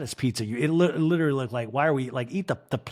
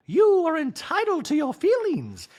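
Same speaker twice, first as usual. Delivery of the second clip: much higher, louder sing-song wife voice; a doting wife, pitched way up; Dorit impression; 4 dB louder than usual. Wife nagging husband about salad, high mock voice. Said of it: "And Dorit's like".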